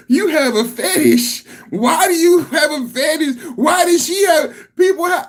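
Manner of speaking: high, giggling mock questions; laughing. Real, not acted. Host mocking the clip, high laughing questions. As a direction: ask it through laughter.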